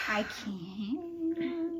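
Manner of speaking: choked-up tearful voice; real crying. Real, not acted. Reader overwhelmed by a sad book, crying. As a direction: let the tears break through the words. Overcome by the book's ending.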